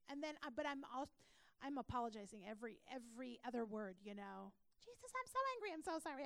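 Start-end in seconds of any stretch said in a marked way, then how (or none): 4.8-6.3 raised, higher exasperated cry of frustration; mock angry outburst at Jesus. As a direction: vent at Jesus like she does when she's frustrated. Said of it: "I do the same thing".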